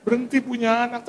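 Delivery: mock the worldly voice shrugging it off; a hint of a weary skeptic saying quit; human way of thinking. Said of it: "Kalau cara manusia saya bilang".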